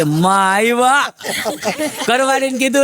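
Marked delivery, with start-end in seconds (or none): 0.0-1.1 stretched out slowly, in awe; slow reverent welcome. Retold or imitated. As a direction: say માં આવ્યા slowly, as if greeting a holy guest. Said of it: "ભાઈને એમ કે".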